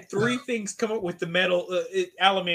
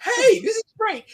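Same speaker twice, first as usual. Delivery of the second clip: mock blissed-out user; 5 dB louder than usual. Imitating someone high on bad drugs.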